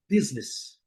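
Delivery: "business" as "BIZ-nis", two syllables; English pronunciation. Shown as a demonstration. Teacher modelling the correct sound, English "business". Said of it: "é inglês".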